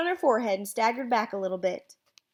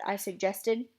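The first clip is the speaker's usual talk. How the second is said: ordinary delivery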